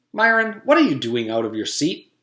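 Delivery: high, loud scolding teacher call; stern teacher. Acted.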